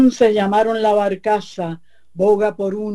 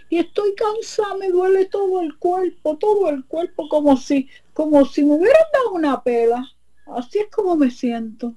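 much higher whiny voice, aching all over; tired complaint; 11 semitones higher than usual. Acted. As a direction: whine like you were beaten all night. La comay se queja, muy aguda. (la comay se queja de cansancio con voz muy aguda y quejumbrosa)

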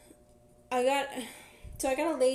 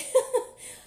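breathy laugh; 13 semitones higher, 5 dB louder than usual. Real, not acted. Laughing at surprise upgrade.

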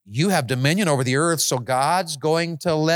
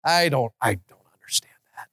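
whisper; 2 semitones lower than usual. Real, not acted. Awed confession after loud prayer.

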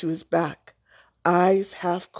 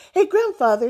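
high, loud hail; eager boyish call, pitched high; 12 semitones higher, 6 dB louder than usual. A narrator's reading. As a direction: pipe up to get attention. Boy calling for grandfather's attention.

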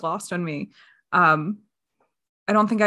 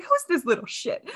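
annoyed; 7 semitones higher than usual. Retold or imitated. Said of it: "you're supposed to be like".

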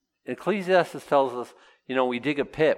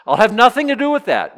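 higher and louder, defiant; higher, louder mock defiance; defiant refusal; 9 semitones higher, 8 dB louder than usual. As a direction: throw the refusal back. Voicing a scoffer.

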